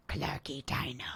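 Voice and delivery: a little toddler naming herself, lightly voiced; imitating toddler. Imitating Clark pointing at her dino, soft toddler voice. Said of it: "she'll go".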